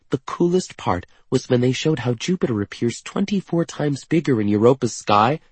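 high, eager wonder; excited raving. A narrator's reading. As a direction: gush about the view. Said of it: "Katerina raves".

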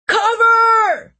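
very high, loud scream of warning. Acted. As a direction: scream it as the blast hits. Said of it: "someone screamed".

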